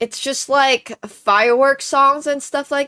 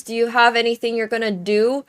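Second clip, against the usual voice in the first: ordinary delivery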